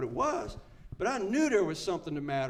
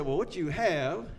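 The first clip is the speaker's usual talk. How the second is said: a hint of a pompous expert, louder; lightly voiced stuffy doctor; mock clinical verdict; 3 semitones higher, 6 dB louder than usual. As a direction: lay out the diagnosis with gravity. Quoting the clinicians.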